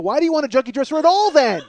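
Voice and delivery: high, loud frustrated demand; exasperated outburst. Retold or imitated. Husband arguing with wife over dresser, exasperated. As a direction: blow up in disbelief.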